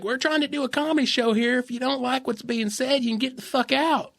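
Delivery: high, indignant put-on voice; bar owner impression. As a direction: mimic a bar owner telling off a heckler. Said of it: "he talked like this".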